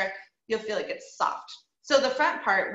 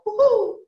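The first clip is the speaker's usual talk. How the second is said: high, loud woo-hoo; bright head-voice owl call; owl hoot; 12 semitones higher, 8 dB louder than usual. Shown as a demonstration. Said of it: "or an owl".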